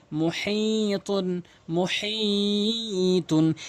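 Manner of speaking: very slow chanted মুহিতুন; Quran recitation. Shown as a demonstration. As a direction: recite মুহিতুন drawn out like tilawah. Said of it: "Quranic recitation demo".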